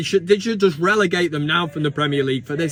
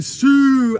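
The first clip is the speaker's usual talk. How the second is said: mock shout of Sue; 6 semitones higher, 6 dB louder than usual. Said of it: "grown men ... going".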